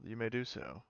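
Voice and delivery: usual speaking voice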